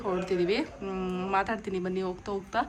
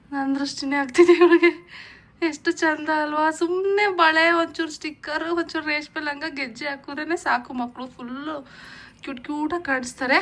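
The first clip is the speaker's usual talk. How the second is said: higher and louder, delighted gushing; 5 semitones higher, 6 dB louder than usual. Real, not acted. Gushing over daughter's outfit.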